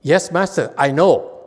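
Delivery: clipped, sure yes, then stop; imitating a Korean student. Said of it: "the Koreans, they would say".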